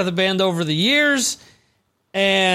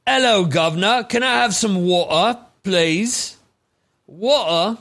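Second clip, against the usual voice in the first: nephew's put-on British accent; mock British accent. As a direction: imitate a kid aping British speech. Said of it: "My oldest kept saying".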